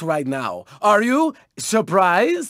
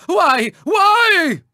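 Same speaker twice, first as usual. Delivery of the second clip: plaintive cry climbing higher; melodramatic wail; 8 semitones higher, 3 dB louder than usual. Acted. Racer lamenting a setback, hammed-up rising wail. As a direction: wail it theatrically, the second one higher.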